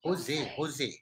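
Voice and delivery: "Jose" with an H, "ho-ZAY"; low, soft "ho-zay" for "Jose"; corrected pronunciation. Shown as a demonstration. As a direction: say "Jose" quietly with an H sound. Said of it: "bukan San Jose".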